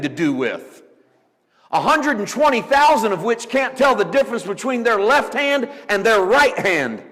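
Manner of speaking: loud, high-pitched preaching challenge; God's rebuke. Acted. God challenging Jonah over Nineveh's people, impassioned loud rebuke. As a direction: press the question at full force.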